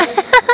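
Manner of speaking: short high-pitched giggle; high squeal of laughter. Real, not acted.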